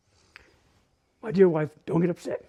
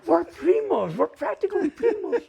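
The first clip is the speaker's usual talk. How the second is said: high, louder, laughing delight; lightly voicing a happy discovery; delighted cousin; 10 semitones higher, 5 dB louder than usual. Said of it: "He goes".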